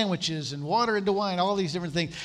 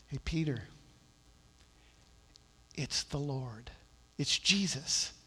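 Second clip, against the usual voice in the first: much softer, low confidential tone; hushed aside; 4 semitones lower, 7 dB quieter than usual. Retold or imitated. John telling Peter it's Jesus, hushed and soft. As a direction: lean in and tell him quietly.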